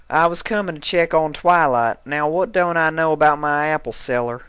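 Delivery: lightly voiced country farm pony; a hint of a drawling farm mare, curious; Applejack voice. A narrator's reading. Applejack checking on her cellar, lightly voiced country mare.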